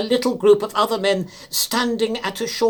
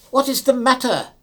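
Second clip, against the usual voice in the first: anxious call; 6 semitones higher, 5 dB louder than usual. Acted. Narrator calls to men below.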